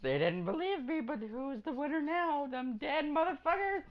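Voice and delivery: gloating. Acted. Gloating about winning.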